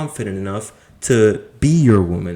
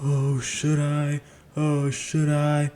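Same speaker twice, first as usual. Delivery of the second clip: soft, slow, unsure mock voice; timid second-guessing, drawn out and soft; meek doubting guy; 4 semitones higher, 6 dB quieter, slower than usual. Mocking the unsure man who doubts quitting his job, soft and slow. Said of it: "you're gonna be like".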